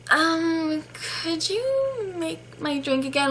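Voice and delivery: high, slow, polite request voice; mock-hesitant customer asking for a favor; imitated hesitant customer. Customer asks for remake, retold.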